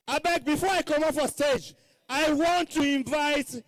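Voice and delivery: MC stage announcement; rally speech. Acted. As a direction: hype up the crowd like an MC. MC introduces dancers on stage.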